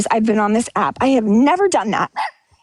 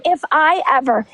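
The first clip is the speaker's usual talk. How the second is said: usual speaking voice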